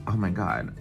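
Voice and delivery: ordinary talk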